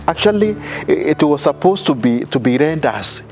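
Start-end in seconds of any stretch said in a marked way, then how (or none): none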